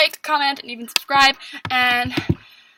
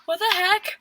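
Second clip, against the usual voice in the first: surprised; 6 semitones higher than usual. Retold or imitated. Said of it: "I'm like".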